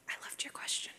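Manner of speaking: a breathy aside whispered just for her. Real, not acted. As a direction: lean in and whisper it privately. Aside to the questioner.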